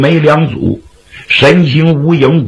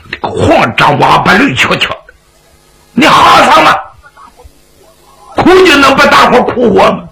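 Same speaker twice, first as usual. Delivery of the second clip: mild angry telling-off, leaping higher at the end; exasperated scolding; 7 semitones higher than usual. Acted. Xu Liang slapping the endless weeper, scolding in exasperation. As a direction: scold the crybaby with rising exasperation. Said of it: "后来把徐良气急了".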